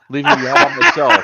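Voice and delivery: very high, loud whine; mock abandoned wail. Host scolding friends who abandoned him, mock wail.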